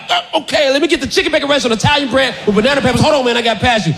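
fast, high blurted order; hurried sandwich order past the guard; rushed order. Retold or imitated. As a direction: race through it before he stops you.

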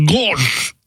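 mouth full. Acted. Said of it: "I got gauze in my mouth".

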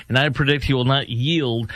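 normal talking voice

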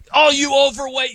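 high, loud mocking wrestling taunt; wrestling heel taunt. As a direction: bellow it like a pro wrestling heel insulting the crowd. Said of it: "the guy comes out and he's like".

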